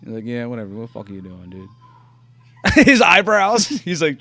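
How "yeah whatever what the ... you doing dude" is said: mock indifference, dropping softer; playing it cool. Imitating a monkey watching the trick, playing it cool. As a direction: act like you could not care less. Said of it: "trying to play it cool".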